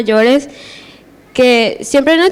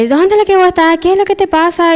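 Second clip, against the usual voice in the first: higher-pitched imitation of a scolding; retold scolding; 7 semitones higher, faster than usual. Family member takes the speaker to task, retold higher-pitched scolding. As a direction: demand answers in a raised pitch, retelling it.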